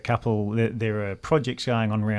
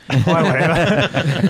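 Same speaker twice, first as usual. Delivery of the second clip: higher, louder, slow and laughing; cheery mock greeting; 6 semitones higher, 9 dB louder, much slower than usual. Caller greeting the bank by phone, slow, laughing and loud. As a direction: ham up a sunny hello to the bank.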